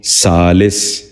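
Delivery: سالس ending "-lis", short i; short i before the final s of سالس; correct pronunciation. Shown as a demonstration. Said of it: "دوبارہ سنیے".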